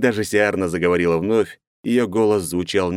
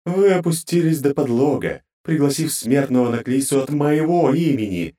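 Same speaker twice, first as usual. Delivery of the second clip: high, grating divine voice; cold goddess interrogating her servants; inhuman goddess voice; 8 semitones higher than usual. Acted. Goddess continues.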